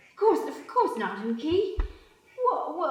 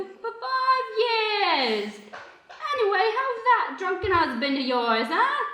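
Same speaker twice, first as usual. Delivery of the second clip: overly bright and nervous; awkward high voice; 9 semitones higher than usual. Acted.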